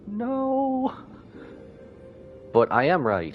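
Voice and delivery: normal talking voice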